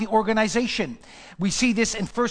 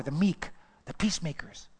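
whispered beatitudes; 3 semitones lower, 6 dB quieter than usual. Acted. Whispered scripture.

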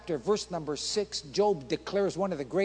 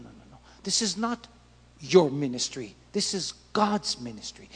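usual speaking voice

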